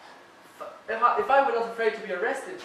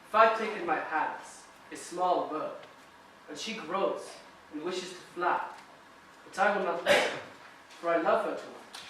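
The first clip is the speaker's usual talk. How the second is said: soothing, softer storytelling; tender; 3 semitones lower, 5 dB quieter than usual. Acted. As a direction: coax her quietly. Gentle parable to calm her.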